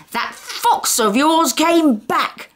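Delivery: high, slow furious bellow. Acted. Angry neighbour bellowing about the fox, furious. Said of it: "bellowed Mister Tugg".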